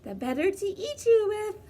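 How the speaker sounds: put-on wolf roaring at the girl. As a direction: leap out and bellow it. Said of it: "roared the wolf".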